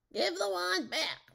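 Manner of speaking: high, loud, slow-stretched yell; shouted demand. A narrator's reading. Rumpelstiltskin lunges at Matilda for the wand, high shouted demand.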